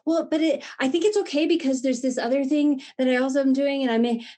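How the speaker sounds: mock-nervous rush to justify; anxious justifying. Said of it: "the way that I normally would".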